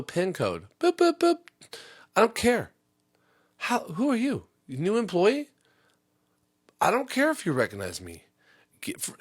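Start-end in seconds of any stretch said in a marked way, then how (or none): 0.8-1.4 high-pitched beeping noises; keypad beeps. Retold or imitated. Customer showing the PIN entry, high beep noises. 2.2-8.2 dismissive retort. Retold or imitated. Customer to a new teller, higher scornful.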